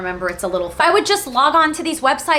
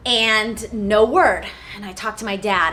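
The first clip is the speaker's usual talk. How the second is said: normal talking voice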